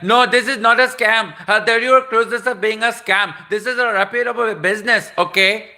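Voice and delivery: loud, very high mock Indian caller; indignant yelling. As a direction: protest in outrage with an imitated Indian accent. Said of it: "he started yelling at me on the phone".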